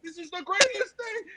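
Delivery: high-pitched, hammed-up gushing; mock delighted client. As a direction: imitate a client overcome with delight. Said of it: "and the client's like".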